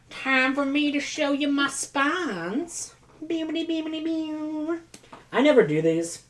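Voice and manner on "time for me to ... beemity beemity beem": high, slow, silly magic-trick voice; playful singsong. Real, not acted. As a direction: present the spines like a goofy reveal. Showing off book spines.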